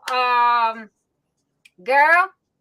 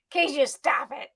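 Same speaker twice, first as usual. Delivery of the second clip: ordinary delivery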